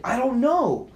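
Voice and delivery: frantic retold answer; exasperated. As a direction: repeat it with mounting exasperation. Answer repeated, more exasperated.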